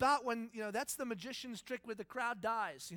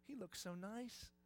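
admiring onlookers; 3 semitones higher, 8 dB quieter than usual. Acted. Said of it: "you all go".

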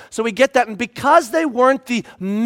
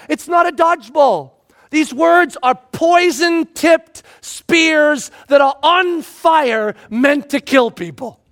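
higher, louder, slow insistent preaching; emphatic warning; 6 semitones higher, 4 dB louder, slower than usual. Retold or imitated. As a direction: hammer each word home. Voicing James's warning.

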